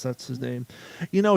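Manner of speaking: normal talking voice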